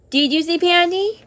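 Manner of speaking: normal talking voice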